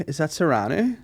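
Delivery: ordinary talk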